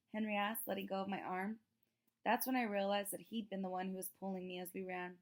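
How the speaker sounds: ordinary delivery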